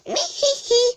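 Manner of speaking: high, giggling cat laugh; tittering cat laugh. A narrator's reading. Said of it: "laughed Wilbur".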